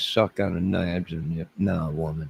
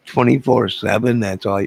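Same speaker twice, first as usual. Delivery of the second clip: emphatic; 5 semitones higher, 8 dB louder than usual. Real, not acted. Repeats phrase with emphasis.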